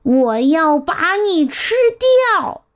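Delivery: acted defiant yell; mild storybook roar. A narrator's reading. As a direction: roar back as a naughty boy, without much volume. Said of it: "麦克斯也吼着".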